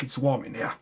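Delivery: ordinary talk, nothing marked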